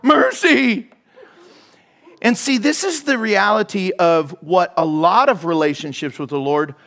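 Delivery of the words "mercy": high, loud plea of giving up; cry of mercy. Acted. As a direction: beg for mercy, louder and higher. Acting out crying mercy.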